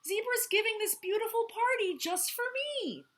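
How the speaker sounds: high, delighted voice; happy. Acted. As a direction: gush with pride. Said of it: "called Moose happily".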